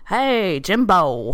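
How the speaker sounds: mock-drunk greeting to a buddy; tipsy Southerner. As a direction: call out like a tipsy country doctor. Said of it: "after he's had a couple of Romulan ales".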